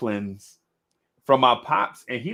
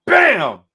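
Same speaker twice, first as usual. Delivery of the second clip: sudden high, loud impact noise; explosive imitated hit; loud "bam"; 8 semitones higher, 11 dB louder than usual. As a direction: shout the sound of a smack.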